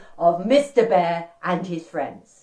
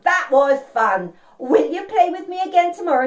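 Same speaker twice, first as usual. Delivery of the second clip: raised, louder, enthusiastic voice; happy storybook bear; 8 semitones higher, 5 dB louder than usual. Acted. Mister Bear asks Dog to play tomorrow, high and enthusiastic. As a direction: bubble over as the delighted bear.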